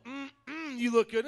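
purring mm then loud look good; flirty compliment. Pretend. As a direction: tease your neighbor flirtatiously. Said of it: "you know, come on".

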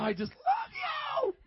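very high, drawn-out gushing squeal; hammed-up adoration, voice shooting way up; mock gushing parent. Preacher acts zapped parent adoring kids, high mock gush.

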